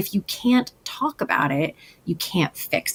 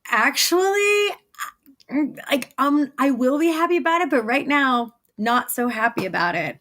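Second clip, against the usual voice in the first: hesitant inner voice; 6 semitones higher than usual. Retold or imitated. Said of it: "my whole body was just like".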